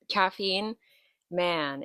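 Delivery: ordinary delivery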